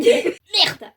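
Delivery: crie « merde » d'un coup, fort et aigu; un juron lâché en fonçant vers la porte; juron crié. Acted. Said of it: "As-tu hurlé". (lâche le juron fort en courant vers la porte)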